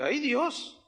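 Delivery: a touch of fright on "Ay, Dios", rising at the end; first "Ay, Dios" as the water starts pulling, mildly worried; mild worried plea; retold alarm. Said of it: "Yo dije".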